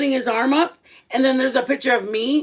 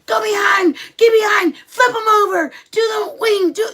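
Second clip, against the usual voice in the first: high, loud shouted wrestling commands; dad screaming moves at his wrestling son; coaching shout; 10 semitones higher, 5 dB louder than usual. Retold or imitated. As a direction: yell instructions from the stands. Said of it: "husband's like".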